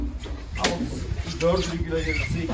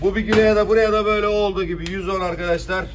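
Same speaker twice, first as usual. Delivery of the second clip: auctioneer; 6 semitones higher, 7 dB louder than usual. Acted. Fish auction calling prices.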